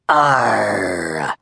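low, drawn-out pirate arr; muttered pirate growl. A narrator's reading. Captain Red sizing up his foes, low pirate mutter. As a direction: grumble it to yourself like a seadog. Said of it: "Red muttered".

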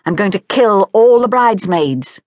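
childish battle threat, voiced up and out; small boy's declaration. A narrator's reading. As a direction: proclaim it like a bloodthirsty small boy. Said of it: "he declared".

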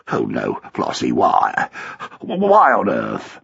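low, slow, pleading groan; sunken, appalled husband; dismayed husband. A narrator's reading. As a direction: protest weakly, stammering on why.

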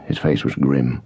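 ordinary delivery, nothing marked

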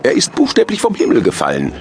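high-pitched thin Santa voice; reply in a very high character voice; high, emphatic Santa; Klaas. A narrator's reading. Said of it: "erwiderte Klaas".

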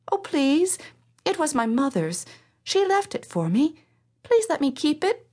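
put-on high, pleading young girl; begging girl. A narrator's reading. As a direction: beg her to let you keep it. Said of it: "begged Silva".

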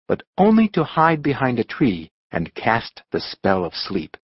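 usual speaking voice

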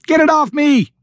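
very high-pitched frantic scream; panicked scream. A narrator's reading. Jennifer panicking at Alan's lack of response, screaming. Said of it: "she screamed louder".

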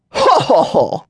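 booming high ho ho ho; guttural laugh. A narrator's reading. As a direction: burst out in a big belly laugh. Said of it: "a long explosion of guttural sounds".

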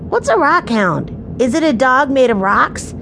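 put-on high-pitched, wondering child's voice; curious little boy. Benny asking what a rockhound is, curious. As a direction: ask like a puzzled small boy.

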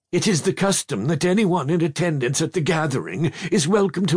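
a gentle hint of a stately great-aunt; dowager aunt. A narrator's reading. Great-aunt calming the boy in the carriage, lightly voiced.

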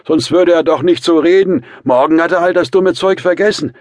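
put-on high, loud, boisterous drunk man; excited drunken yelling; tipsy shouting. A narrator's reading. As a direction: rant loudly, slightly drunk. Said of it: "schrie der angetrunkene Rasumichin".

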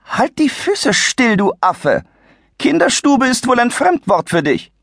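high, shrill-voiced old lady snapping in anger; hissing fury. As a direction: spit it at him like an outraged harpy. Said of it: "fauchte ihn das lila Schreckgespenst an".

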